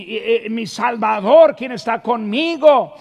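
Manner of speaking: ordinary talk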